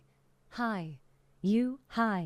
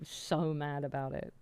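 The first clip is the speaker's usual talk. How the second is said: ordinary delivery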